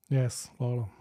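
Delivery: usual speaking voice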